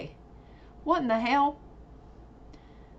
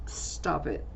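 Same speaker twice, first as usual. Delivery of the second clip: ordinary delivery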